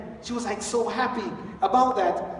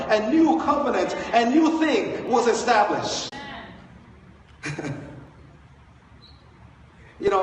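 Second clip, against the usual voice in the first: normal talking voice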